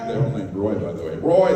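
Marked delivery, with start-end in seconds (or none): 1.2-1.5 loud high call across the room. Retold or imitated. Truckers shouting for another Roy, loud high call. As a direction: yell the name to summon a buddy. Truckers calling each other.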